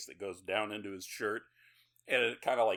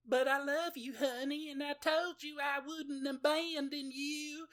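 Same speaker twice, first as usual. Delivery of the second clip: put-on pleading girlfriend voice. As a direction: beg him sweetly, way up high. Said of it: "Starla's trying to convince him, like".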